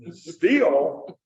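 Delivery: "still" stretched into "steel"; "still" drawn out with a long ee vowel; STILL as steal. Shown as a demonstration. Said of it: "Am I robbing somebody?".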